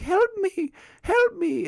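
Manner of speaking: very high, drawn-out mock plea; mock talking severed head. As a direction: imitate a creepy head begging for rescue. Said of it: "the head ... comes alive and says".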